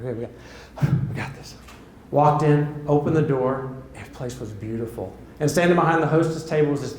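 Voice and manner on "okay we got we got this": low, hushed muttering to himself; nervous self-pep talk. Retold or imitated. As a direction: psych yourself up under your breath. Psyching himself up at the door.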